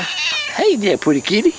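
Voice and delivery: high sweet pet talk to a shy kitty; cooing at a kitty. Real, not acted. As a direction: coax the shy cat with a high coo.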